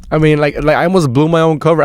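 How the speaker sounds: normal talking voice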